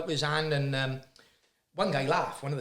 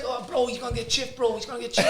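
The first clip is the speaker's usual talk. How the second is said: high-pitched imitated London street accent; Top Boy street accent; 7 semitones higher than usual. Local kid copying Top Boy, mock London street accent. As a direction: mimic a kid's put-on London accent. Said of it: "Accents changed overnight".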